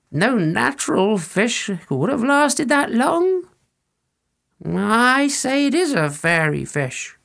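a creaky village elder recalling the fish; old villager. Acted. Old villager telling the legend, high and slow.

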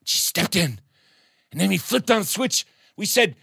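plain reading voice

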